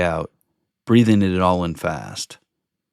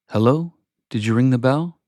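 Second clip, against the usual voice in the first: higher questioning lilt; a hint of a lady answering the door, pitched up; lightly acted, higher-pitched inquiry; lightly voiced church lady; 5 semitones higher than usual. A narrator's reading. Lady at church door greets narrator.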